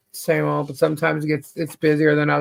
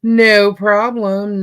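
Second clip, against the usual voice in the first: slow stretched reassurance; 3 semitones higher, 4 dB louder, much slower than usual. Real, not acted. To the viewer who may go mute, slow easy no problem. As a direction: draw out no problem, easy and unhurried.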